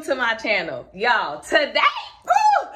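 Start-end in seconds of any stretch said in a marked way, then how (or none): 2.2-2.7 high, loud happy whoop; excited whoop. Real, not acted. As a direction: let out a big joyful woo. Excited cheer before announcement.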